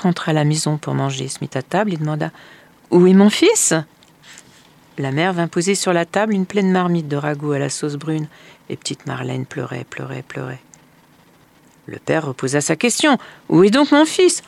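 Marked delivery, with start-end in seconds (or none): 2.9-3.7 louder, questioning father; an unsuspecting father at the table; father asking about his son, louder. A narrator's reading. 13.5-14.4 high, loud repeated question; father asking again for his son, high and loud; father insisting. A narrator's reading.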